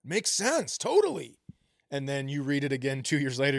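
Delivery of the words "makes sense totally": higher-pitched, wide-eyed agreement; naively convinced, pitch leaping up; eager agreement. Acted. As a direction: swoop up high, totally on board. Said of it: "you're like".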